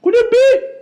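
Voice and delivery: loud, high rhetorical question; preaching shout. Real, not acted. Preacher challenging his listeners with a loud question. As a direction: hit the question hard and high.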